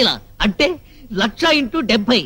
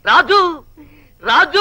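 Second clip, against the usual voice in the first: raised, stretched call for help; 2 semitones higher, slower than usual. Acted. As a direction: call the name out long, rising. Calls out for Raju to help. (గట్టిగా సాగదీసి పిలుస్తూ)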